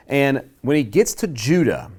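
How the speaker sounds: normal talking voice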